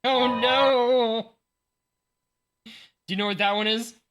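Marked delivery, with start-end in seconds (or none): 0.0-1.3 playful dismay. Real, not acted. Mock dismay at fan-printed cards.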